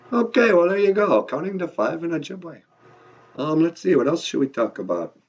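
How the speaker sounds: usual speaking voice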